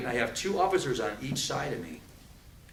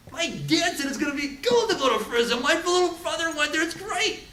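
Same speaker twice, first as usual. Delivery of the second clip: much higher mock little kid bragging; imitating a naive kid, high and eager; 14 semitones higher, 4 dB louder than usual. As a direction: pitch way up like a clueless boy who thinks prison is cool. Mimicking naive kids about prison.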